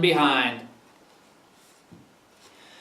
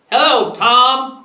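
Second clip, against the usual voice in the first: higher, louder, drawn-out hello; calling greeting; 6 semitones higher, 5 dB louder, slower than usual. A narrator's reading. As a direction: call out a slow hello in passing. Calling greeting to passing turkey.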